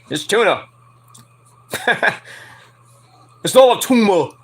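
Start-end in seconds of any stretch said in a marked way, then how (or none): 3.4-4.3 loud put-on Schwarzenegger accent. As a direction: bark it like Kindergarten Cop. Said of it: "as Nick would say".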